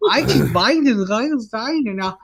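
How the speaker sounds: high-pitched parody of German; hammed-up German-sounding outburst; mock German accent. Showing friends how German sounds, mock German accent. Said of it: "Everything in German sounds".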